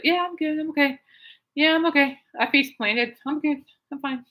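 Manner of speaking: high, quick assurances from the floor; reassuring dad. Retold or imitated. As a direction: brush it off, insisting you're fine. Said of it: "I'm like".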